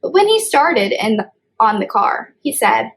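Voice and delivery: put-on reading a picture book aloud to kids; storybook reading. A narrator's reading. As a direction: read it like story time for little ones. Child reads storybook aloud.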